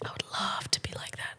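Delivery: whispered aside; soft unvoiced repeat. Shown as a demonstration. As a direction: breathe it as a secret wish.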